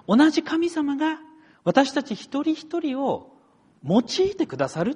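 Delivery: high, emphatic sermon phrases with a pause; impassioned preaching. Real, not acted. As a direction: proclaim it high and deliberately to the congregation. Preaches the main point.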